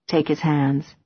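usual speaking voice

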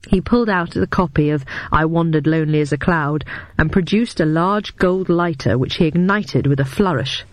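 ordinary delivery, nothing marked

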